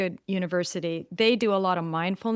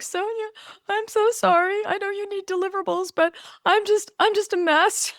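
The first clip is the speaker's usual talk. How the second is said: much higher, whiny mock-wail of apology; frazzled apology; 12 semitones higher than usual. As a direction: squeak out a frantic sorry to the coach. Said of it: "and I was like".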